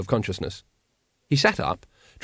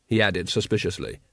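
usual speaking voice

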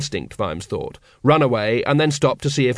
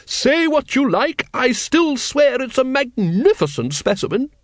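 high, hearty lady's voice, drawn out; booming lady; 11 semitones higher than usual. Acted. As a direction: proclaim it grandly from above. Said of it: "A voice immediately above him rumbled".

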